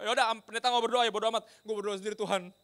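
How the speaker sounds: loud, high, fed-up outburst; imitated exasperation. Preacher voices sailors praying without the pastor, exasperated. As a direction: act out giving up on someone, loudly.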